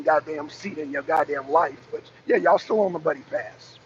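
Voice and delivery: normal talking voice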